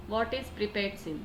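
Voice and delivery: ordinary delivery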